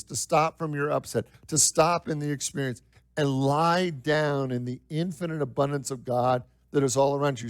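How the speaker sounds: ordinary delivery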